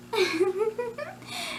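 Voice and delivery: high-pitched staccato giggle; high character giggle. Shown as a demonstration. Creator giggles as the character, high staccato laugh.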